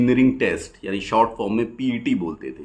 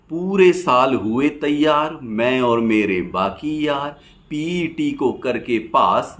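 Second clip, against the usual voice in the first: rhythmic rhymed verse, pausing after each line; poem recitation; 4 semitones higher, 5 dB louder, slower than usual. Acted. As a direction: recite it as lilting stage poetry.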